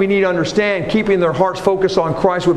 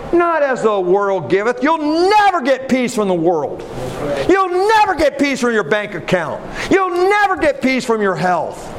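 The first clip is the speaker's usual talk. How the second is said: high-pitched, emphatic preacher's proclamation; impassioned preaching; 8 semitones higher than usual. Real, not acted. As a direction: proclaim the promise, leaning hard on key words.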